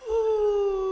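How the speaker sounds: high far-off howl; thin high wolf oh. Acted. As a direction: howl from the distant mountain. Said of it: "¿cómo le hacía?".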